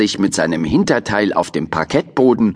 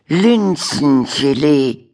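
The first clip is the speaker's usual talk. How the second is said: slow halting reading; 5 semitones higher, much slower than usual. Acted. Turtle misreads street sign.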